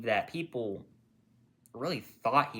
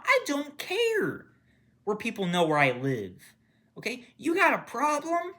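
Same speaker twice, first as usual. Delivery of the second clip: loud, high, heated yelling; furious yelling at masked protesters; angry rant; 9 semitones higher, 4 dB louder than usual. Real, not acted. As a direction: shout it at them in real anger. Angry rant about ICE protesters.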